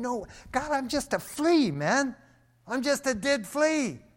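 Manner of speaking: high, stunned mock protest; overwhelmed David. David protesting to God he's unworthy, high astonished voice. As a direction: squeak it out in humble disbelief. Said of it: "David was blown away. He's going".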